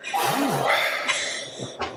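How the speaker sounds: long, audible breath out, unvoiced; demonstrated deep sigh. Shown as a demonstration. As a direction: show a slow, calming exhale. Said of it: "I just gotta take a breath".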